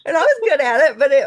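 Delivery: loud, high, laughing words; giggling at herself. Real, not acted. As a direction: say it through a laugh. Laughing at the real job remark.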